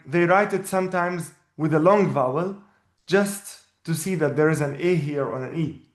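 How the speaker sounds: ordinary delivery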